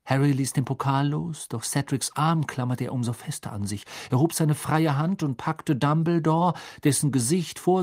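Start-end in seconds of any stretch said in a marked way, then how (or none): none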